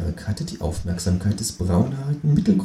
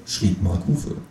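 ordinary delivery, nothing marked

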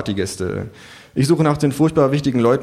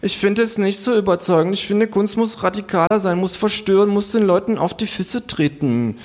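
put-on higher, earnest young artist; young man; 10 semitones higher than usual. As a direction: preach radical art as the young man. (höher, eifriger junger Künstler)

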